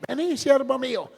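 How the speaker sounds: high-pitched mock oracle summons; put-on high prophetic call; imitated prophetess. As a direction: beckon like a prophetess calling her servant. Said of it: "Y había una profeta".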